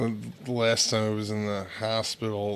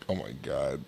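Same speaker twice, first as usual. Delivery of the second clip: quiet aside; 9 semitones lower, 9 dB quieter than usual. Retold or imitated.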